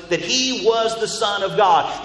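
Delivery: ordinary talk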